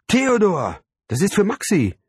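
high, loud mother bubbling with enthusiasm; delighted calling out. A narrator's reading. Mother calls to father about Maxi's visitors, delighted. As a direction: call it out brightly, thrilled. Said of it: "rief meine Mutter begeistert".

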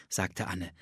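ordinary delivery

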